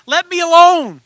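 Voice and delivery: high, loud outburst; protesting yell. Acted. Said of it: "I yelled out".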